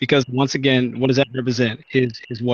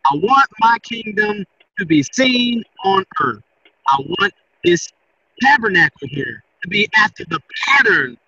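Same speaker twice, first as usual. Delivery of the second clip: higher-pitched, punchy sermon delivery; emphatic preaching; 6 semitones higher, 3 dB louder than usual. Acted. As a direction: drive each point home like a preacher. Preaches on God's commands.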